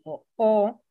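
normal talking voice

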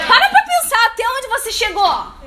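fervent exhortation. Real, not acted. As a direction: rouse them to keep going. Exhorting congregation.

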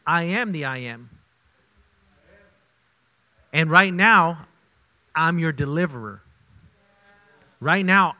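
loud, booming voice of God declaring; God's voice, preached. Retold or imitated. Preacher voices God speaking boldly.

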